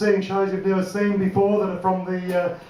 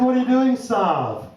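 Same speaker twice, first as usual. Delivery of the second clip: mock Aussie mate. Mate asking his plans, lazy Aussie slang. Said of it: "He said".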